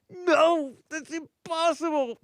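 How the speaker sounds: high mocking disbelief; mocking flat earther. Host mocking flat earthers, high squeaky voice. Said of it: "flat earthers".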